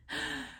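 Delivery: a sharp shocked gasp, hammed up; dramatic gasp. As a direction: gasp like mom hearing awful news. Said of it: "she's so intense and dramatic".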